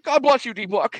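hammed-up called-out blessing; retold called blessing. Retold blessing, acted once more.

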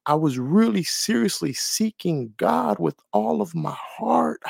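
normal talking voice

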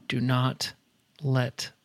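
self-restraint. Retold or imitated. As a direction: hold back anger, one word group at a time. Retelling restrained anger at daughter.

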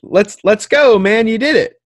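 high, loud mock cheer; half-hearted enthusiasm. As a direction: cheer him on without really being hyped. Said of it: "like, half-assed enthusiastic about it".

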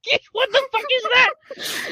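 very high, drawn-out mock Indian-accent scream; slow, high Indian-accent impression, cracking up. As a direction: squeal it in an imitated Indian accent through laughter. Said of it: "screaming his name in this freaking Indian accent".

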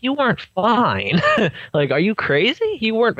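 high, exasperated are-you-crazy; incredulous disbelief. Retold or imitated. Disbelief at overdosed girl's denial.

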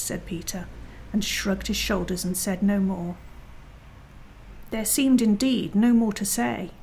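ordinary delivery, nothing marked

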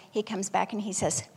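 ordinary delivery

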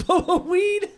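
high, giddy, laughing shout; mock fugitive glee. Smuggler bolting for the border with weed, high and giddy. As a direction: play a gleeful runaway. Acted runner taunting border police.

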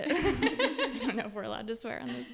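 said through an embarrassed laugh. Real, not acted. Guest to host after cursing on the podcast, laughing. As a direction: laugh while owning up to a swear word.

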